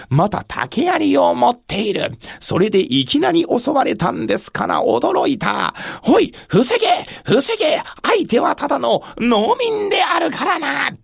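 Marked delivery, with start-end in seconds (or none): 6.1-10.9 higher, urgent commands; rally the troops in a panic; shouting orders. Acted. Soldiers ordering defense. (慌てて叫ぶ)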